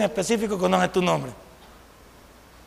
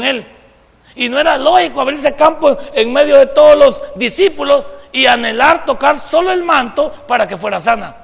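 forceful preaching, building louder; impassioned preaching; 3 semitones higher, 5 dB louder than usual. Real, not acted. Preacher tells of woman daring to touch the cloak, impassioned preaching.

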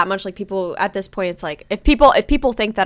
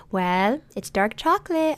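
slow, drawn-out mom voice; imitated coy mom; 3 semitones higher, 3 dB quieter, slower than usual. Mom reveals the snack, slow coy put-on voice. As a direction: imitate a mother coyly revealing a treat.

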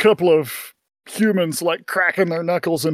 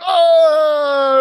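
cheering shout; 11 semitones higher, 5 dB louder than usual. Acted. Crowd cheering narration.

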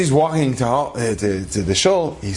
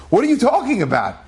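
higher-pitched retold disbelief; mock bewilderment; 7 semitones higher than usual. Rav grabbing the boy's hand, mock bewildered. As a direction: demand an answer in acted astonishment. Rav grabs boy, demands answer.

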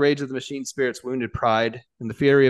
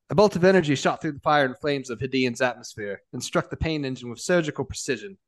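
ordinary delivery

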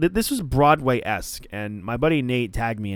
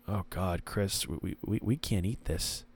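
hushed, much softer mock disgust; a soft, understated gag of disgust at rare steak; 6 semitones lower, 14 dB quieter than usual. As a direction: recoil from the rare steak, quietly. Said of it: "disgust, like".

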